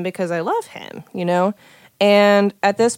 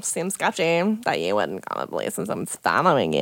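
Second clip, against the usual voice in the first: low, quieter doubting mimicry; imitated sceptical police officer; 10 semitones lower, 5 dB quieter than usual. Quoting police who doubt the story, low and sceptical. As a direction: drop well down to mimic a doubtful cop.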